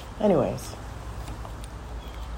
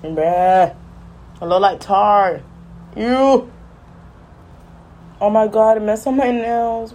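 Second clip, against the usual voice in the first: higher-pitched, drawn-out dismay; dismayed disgust; 5 semitones higher, slower than usual. Real, not acted. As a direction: recoil at the mess on your own nails. Disgusted at resin on her nails.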